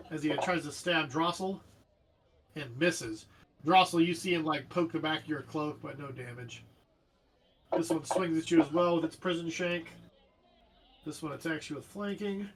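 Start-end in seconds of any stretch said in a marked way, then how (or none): none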